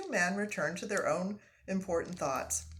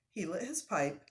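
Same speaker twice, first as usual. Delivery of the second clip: usual speaking voice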